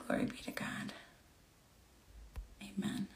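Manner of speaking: breathy whisper of praise. Real, not acted. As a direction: whisper it under your breath.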